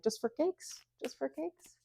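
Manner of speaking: high, confused echo of cakes; mock puzzled friend asking about cakes; a hint of a baffled friend; puzzled mishearing. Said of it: "they were like".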